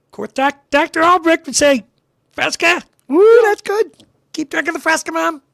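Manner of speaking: high, loud cartoonish outburst; exaggerated childhood character. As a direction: shout it out in a hammed-up, high-pitched voice.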